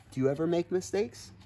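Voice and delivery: usual speaking voice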